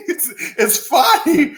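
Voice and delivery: giggling through the words; can't hold back a laugh. Real, not acted. Host cracking up on air, laughing. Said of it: "I'm about to laugh".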